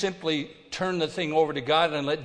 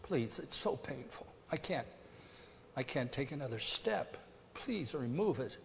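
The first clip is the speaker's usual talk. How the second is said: quiet begging; 9 dB quieter than usual. Retold or imitated. Preacher voices Paul begging God, soft plea. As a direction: murmur it like a tired plea. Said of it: "begged God".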